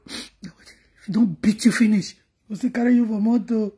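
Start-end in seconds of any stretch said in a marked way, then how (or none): none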